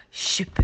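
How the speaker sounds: a quick shoop, like dung flying; splat sound. Acted. Narrator makes the dung-splat noise. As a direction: make the splat of dung thrown back.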